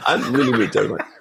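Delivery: fast, laughing through it. Retold or imitated. Retelling his answer to the stranger, laughing. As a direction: rush it out with a laugh. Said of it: "I'm like".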